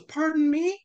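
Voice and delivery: sharp, high, loud affronted question; a shocked woman, caught off guard; high indignant "pardon me"; offended gasp. Acted.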